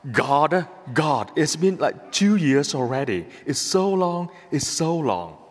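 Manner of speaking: ordinary talk, nothing marked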